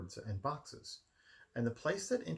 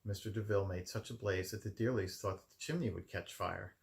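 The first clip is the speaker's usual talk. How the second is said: usual speaking voice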